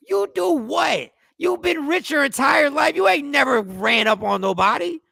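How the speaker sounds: high, loud disbelieving challenge; loud incredulity. Real, not acted. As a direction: call out the absurd boast, voice shooting up. Mocking disbelief at the boast.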